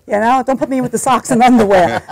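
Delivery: hammed-up baby scolding its mother; mock baby complaining. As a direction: play the indignant newborn in the drawer. Baby self talking to mom.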